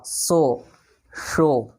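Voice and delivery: सो with s, then शो with sh; सो hissed, शो hushed; s versus sh. Shown as a demonstration. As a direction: contrast सो and शो. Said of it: "अंतर आपको लग रहा होगा".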